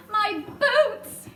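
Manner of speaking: high, loud dismayed wail; fussy centipede fretting over his boots; hammed-up dismay; cried out. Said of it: "cried the centipede".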